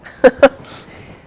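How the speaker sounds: bright burst of laughter; laughing. Real, not acted. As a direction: laugh at the pirate joke. Laughing at pirate joke.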